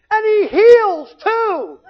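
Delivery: high, loud and slow, thrilled; eager interjection. Retold or imitated. Mother cutting in, thrilled, high and loud. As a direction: cut in, delighted at the money to be made. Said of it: "my mother jumps in and goes".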